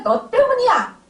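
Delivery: 훨씬 높고 크게 내지르는 "너 때문이야"; 어머니에게 화를 터뜨리듯 외쳐; 원망의 외침. Retold or imitated. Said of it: "이렇게 되는 거예요". (치매 어머니에게 화내며 외치는 고함)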